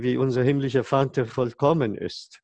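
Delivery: usual speaking voice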